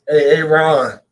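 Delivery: ordinary delivery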